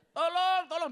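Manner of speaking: high, loud hammed-up call; drowning man calling for help, hammed-up; mock cry for help. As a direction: play a drowning man yelling.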